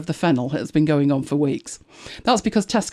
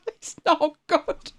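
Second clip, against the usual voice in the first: slow, higher, laughing voice; 10 semitones higher, 3 dB quieter, slower than usual. Real, not acted. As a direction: say it slowly through helpless laughter. Laughing at failing to read it.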